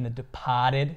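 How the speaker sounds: "Departed" with dropped R's, Boston style; mock Boston accent, "De-pah-ted". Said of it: "really bad Boston accent".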